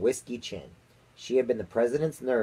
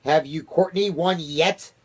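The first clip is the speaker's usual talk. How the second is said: slow, loud, raised-pitch demand, a touch icy; 7 semitones higher, 6 dB louder, slower than usual. A narrator's reading. Miss Tibbs questioning the chief spy, slow icy demand. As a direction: press the question slowly and firmly. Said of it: "in an icy voice".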